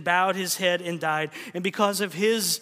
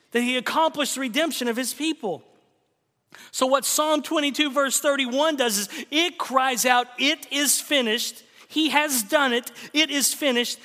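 rising preaching; 6 semitones higher than usual. Acted. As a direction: proclaim it in triumph. Preaching rises again.

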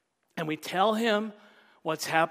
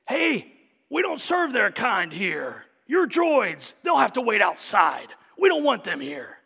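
hammed-up Star Wars bartender turning droids away; gruff bartender; 3 semitones higher, 6 dB louder than usual. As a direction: bark the droids out of the bar. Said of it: "he says this".

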